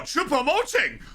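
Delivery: high, loud cheer of the name, dropping at the end; excited shout. Real, not acted. Streamer cheering a player during the match, excited shout. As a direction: yell the name as he makes the play.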